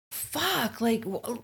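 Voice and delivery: high, sharp frustrated "fuck"; frustrated curse. Retold or imitated. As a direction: snap in exasperation. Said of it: "you're like".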